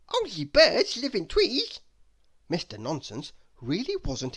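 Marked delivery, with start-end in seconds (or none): 0.1-1.8 protesting character. Acted. Mister Nonsense protests.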